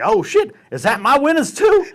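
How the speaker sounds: loud, high-pitched joking question; hammed-up high exclamation; mock surprise. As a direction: feign amazement at a pile of chips. Reenacts joking over knocked-over chips.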